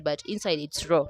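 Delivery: ordinary talk, nothing marked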